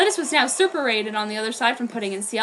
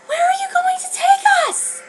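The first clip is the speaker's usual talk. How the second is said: put-on very high, pleading girl's voice; 4 dB louder than usual. Lettuce, carried off by Ciel, begs in a high voice. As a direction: squeak the question in fright. Girls screaming while kidnapped.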